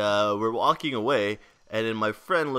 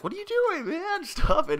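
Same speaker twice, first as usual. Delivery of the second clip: friend's protest in a much higher squeaky voice; mock high-pitched protest. Friend pushing a robber's hand away, high mock squeal. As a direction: squeal it out like an outraged friend, breaking into a laugh.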